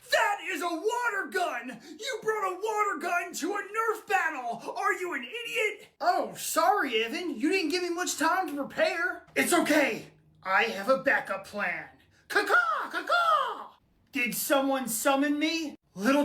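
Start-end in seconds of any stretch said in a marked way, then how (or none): 0.1-5.9 high, incredulous outrage; outraged scolding. Acted. Brother outraged at the wrong gun. 12.3-13.7 very high, drawn-out cawing; bird call. Acted. Bird-call signal to summon helper.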